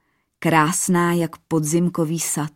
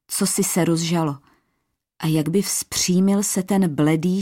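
plain reading voice

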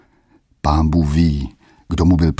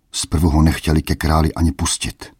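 ordinary delivery, nothing marked

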